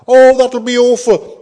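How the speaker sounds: high, loud, hammed-up horror; mock dismay. Preacher voicing a mock objection from a listener, hammed-up dismay. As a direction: pretend to be appalled by the thought.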